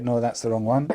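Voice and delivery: ordinary delivery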